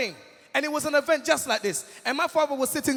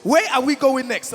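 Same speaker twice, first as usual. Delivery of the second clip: loud, keen imitation of a father; hammed-up paternal enthusiasm; eager dad; 8 dB louder than usual. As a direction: announce yourself loudly as the new manager. Dad suddenly keen to manage son.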